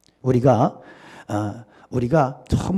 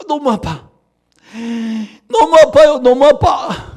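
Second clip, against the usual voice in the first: much higher and louder, crying out in pain; a yelping acted cry of an arrow victim; a hammed-up wail of hurt; 10 semitones higher, 5 dB louder than usual. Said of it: "화살이 이렇게 둘을 관통했어".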